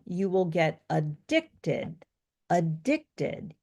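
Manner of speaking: slow adDICTed, stressing DICT; "addicted" drawn out with a strong middle syllable; stress on second syllable. Shown as a demonstration. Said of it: "you say addicted".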